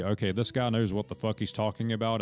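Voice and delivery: ordinary delivery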